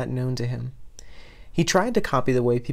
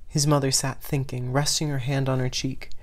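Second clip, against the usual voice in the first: usual speaking voice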